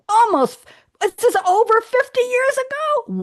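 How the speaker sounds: high-pitched squeal of disbelief; amazed squeak at the date; incredulous. Real, not acted. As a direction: shoot way up in pitch, astonished. Amazed at the film's age.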